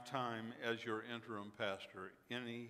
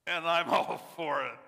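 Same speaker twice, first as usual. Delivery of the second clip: laughing; 4 semitones higher, 11 dB louder than usual. Real, not acted. Laughing at the race's end.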